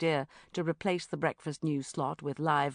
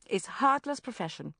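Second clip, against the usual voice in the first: usual speaking voice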